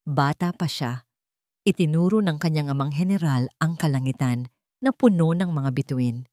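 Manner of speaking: usual speaking voice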